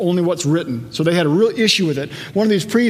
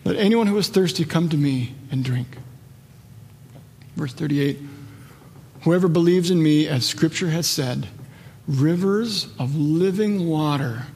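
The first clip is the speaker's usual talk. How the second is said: slow, lowered reverent reading; hushed scripture reading; 4 semitones lower, 5 dB quieter than usual. Real, not acted. Preacher reading Jesus's promise of living water, hushed. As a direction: read the verse softly, letting phrases settle.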